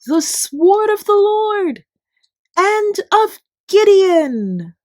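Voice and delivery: very high, very loud, slow triumphant shout; shouting battle cry. A narrator's reading. The three hundred men charging the Midianite camp, roaring the battle cry. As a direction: roar it out as the army charges. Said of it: "while shouting".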